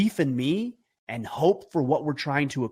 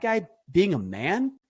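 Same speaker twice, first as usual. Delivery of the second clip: ordinary delivery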